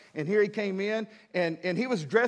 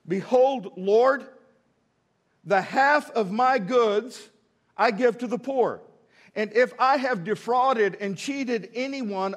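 scripture reading; 3 semitones higher, 5 dB louder than usual. Acted.

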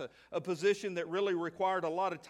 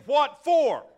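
loud, high, indignant retort; indignant what for; 7 semitones higher, 11 dB louder than usual. Acted. Martha giving her sister and Jesus an indignant what-for. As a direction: throw it back in their faces. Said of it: "She gave them both the big".

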